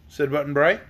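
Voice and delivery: usual speaking voice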